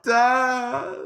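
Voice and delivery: son's heartbroken call, voiced by his father; crying child. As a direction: imitate a sobbing five-year-old calling his dad. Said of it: "He's crying his eyes out".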